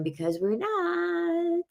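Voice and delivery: high, soft, drawn-out finish; mock refusal. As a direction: stretch it up into a squeak. Acts out anxious refusal.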